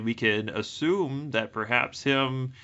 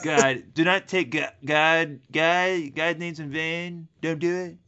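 lightly Trumpy, higher with stammered God; hint of Trump, higher pitched; Trumpy take; 6 semitones higher, slower than usual. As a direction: play Trump tripping on the word. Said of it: "I'm getting some Trumpy vibes".

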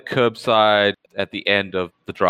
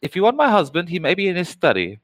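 high, sugary hostess voice; sweet 1950s housewife; 8 semitones higher, much faster than usual. Acted. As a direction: fuss over the fallen guest, much higher.

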